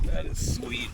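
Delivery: soft, breathy satisfied sigh; hushed awe. Real, not acted. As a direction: let the relief sink in. Awe after landing fish.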